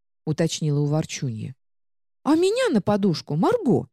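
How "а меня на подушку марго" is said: high, squeaky indignant book; a fussy little magic book protesting; talking book voice. Acted. Talking book demands pillow.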